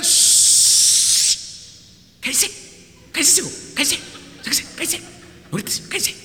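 urgent hissed shushing; hushing crowd. Acted. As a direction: shut him up quickly, half whispered. Crowd hushing the blind beggar. (la gente callándolo con siseos urgentes)

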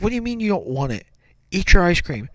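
mock-indignant dad voice; exasperated dad. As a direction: demand like a fed-up parent. Retold exasperated father scolding child.

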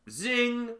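high sung-out zing like a blade; quick high sound-effect zing; saw zing. Retold or imitated. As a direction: mimic the saw whipping through. Imitating the saw hitting hand.

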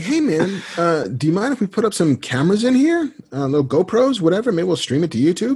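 high, casual friend voice; Kevin impression. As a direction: imitate a friend slipping in a new scheme. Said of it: "The way you do his".

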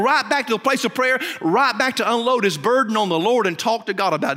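a lift into fervent preaching; rising preaching. Acted. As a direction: preach it up with urgency. Preaching builds about Moses' pressure.

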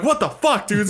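high-pitched outburst of alarm; mock panicked exclamation; retold freak-out. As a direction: act out a startled freak-out, voice jumping way up. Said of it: "so we all freak out".

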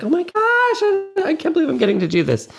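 high, fast, thrilled gush; giddy excitement. Retold or imitated. On set, thrilled to be filming, high and fast. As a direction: gush like a starstruck kid. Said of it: "you're like".

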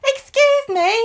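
put-on very high, insistent child voice; little Sam. Child interrupts the man on his phone, squeaky insistence. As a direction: squeak it like a persistent little kid. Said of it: "And Sam goes".

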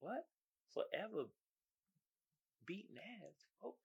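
lightly voiced bewildered onlookers, very soft; baffled crowd. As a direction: play a puzzled crowd, hushed and questioning. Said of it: "The crowd is like".

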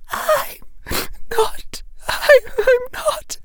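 crying out each word between sobs; sobbing. A narrator's reading. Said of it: "Bellatrix was sobbing harder".

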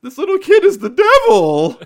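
high, loud, stretched-out sitcom shout; mock horrified yell. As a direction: holler it in hammed-up alarm. Joke line as exasperated sitcom parent.

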